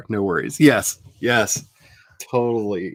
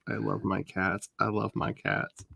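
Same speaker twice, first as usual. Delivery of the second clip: soft repeat; 11 dB quieter than usual. Real, not acted. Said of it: "repeated softly".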